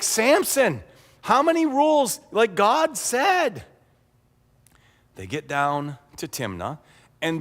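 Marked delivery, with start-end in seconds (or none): 0.0-3.6 high, loud scolding yell; horrified. Pretend. Parents scolding Samson for breaking the rules, horrified. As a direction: cry out in mock parental horror. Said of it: "they would be horrified".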